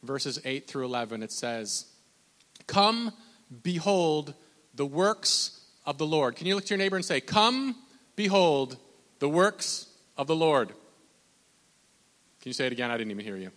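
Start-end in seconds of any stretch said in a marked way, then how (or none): 2.7-6.3 slow, emphatic scripture proclamation; preach. Acted. Reads scripture to congregation. 7.2-10.7 slow, emphatic scripture proclamation; preach. Acted. Leads congregation in verse.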